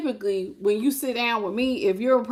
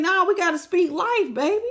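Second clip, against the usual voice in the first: higher, bright-spirited encouragement; upbeat affirmation; 9 semitones higher than usual. Shown as a demonstration. Teaching listeners the right words, upbeat and higher. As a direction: lift them up with it. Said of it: "You gotta be saying".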